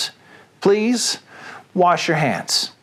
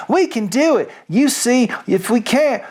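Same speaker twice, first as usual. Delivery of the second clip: put-on higher, eager young voices insisting; determined fox cubs; 8 semitones higher than usual. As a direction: cubs promising they can dig. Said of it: "cried the small foxes".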